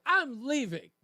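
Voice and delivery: dramatic mock announcement of leaving; mock walkout threat. Host mimicking people who threaten to leave, mock-dramatic. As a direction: declare it high, then falling. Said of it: "when people say".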